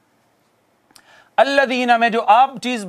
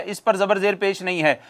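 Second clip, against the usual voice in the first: usual speaking voice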